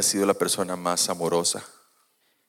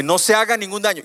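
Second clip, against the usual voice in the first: much higher, urgent call; shouted plea; 10 semitones higher, 4 dB louder than usual. Retold or imitated. Paul shouting to the jailer, raised and urgent. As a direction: cry out to stop him. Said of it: "Y le grita".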